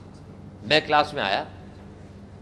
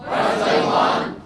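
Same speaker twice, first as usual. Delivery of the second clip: slow unvoiced stretched greeting; drawn-out breathy whisper; 6 dB louder, much slower than usual. Shown as a demonstration. Teacher showing the class a drawn-out whispered greeting. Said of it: "बोलो".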